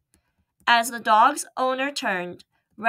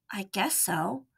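weary; 8 dB quieter than usual. Acted. Sick boy gives in weakly.

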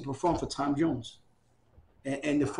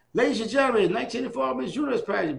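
higher, louder showman announcement; TV announcer; 8 semitones higher, 5 dB louder than usual. Retold or imitated. Imitating the TV host opening the pageant, announcer voice. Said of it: "He turns on the T V".

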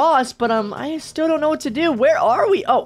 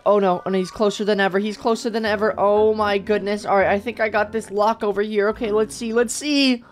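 ordinary delivery

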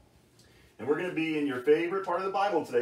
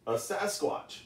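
ordinary talk

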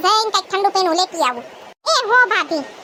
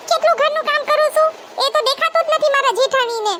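put-on higher, girlish grumbling voice; young bride grumbling about her sister-in-law, higher voice; complaining young bride; 6 semitones higher than usual.

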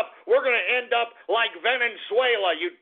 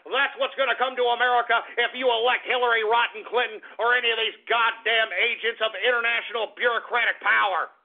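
normal talking voice

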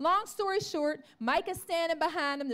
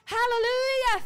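a loud, higher hallelujah of joy; rejoicing; 6 semitones higher, 6 dB louder than usual. Retold or imitated. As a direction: let the praise lift up with delight. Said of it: "he begins to rejoice".

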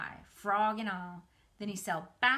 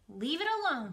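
commanded; 7 semitones higher, 4 dB louder than usual. A narrator's reading. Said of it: "commanded Fern".

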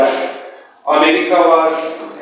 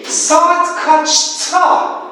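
slow teacher question; much slower than usual. Shown as a demonstration. Teacher asks class the time.

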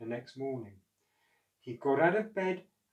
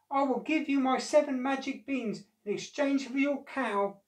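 put-on high, light old man's voice; lightly voiced funny little man; a friendly little old man offering a trade; 10 semitones higher, 3 dB louder than usual. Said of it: "said the little old man".

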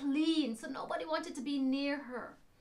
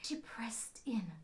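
hushed whisper; 3 semitones lower, 7 dB quieter than usual. A narrator's reading. Storyteller hushing children.

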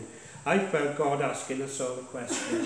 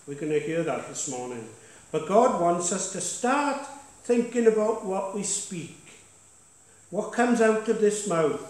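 punchy pulpit declaration; preaching; 4 semitones higher than usual. Acted. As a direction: exhort the church, pushing key words. Pastor exhorts congregation from the front.

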